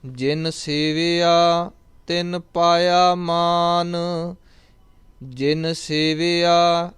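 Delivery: slow, drawn-out devotional chanting of each line; scripture recitation. Acted. Teacher reciting Gurbani for students, slow devotional chant. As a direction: intone the verse slowly and reverently.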